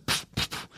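soft little voiceless puffs; puffed sweat-burst noises. Retold or imitated. Every pore starts to sweat, soft imitated spurts. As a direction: puff out tiny bursts of air.

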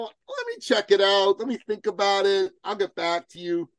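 high, put-on brush-off; noncommittal mock stalling. Voices a noncommittal ditherer to reject.

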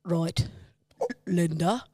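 lightly done, low Brummie try that breaks up; half-hearted attempt at the Peaky voice; attempted Birmingham accent. Shown as a demonstration. Friend trying the impression for comparison, weak Brummie accent. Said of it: "We need comparison".

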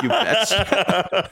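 high, slow Minnesotan "you betcha" through laughter; mock Minnesotan accent. Laughing host echoing his co-host's catchphrase, mock Minnesotan accent.

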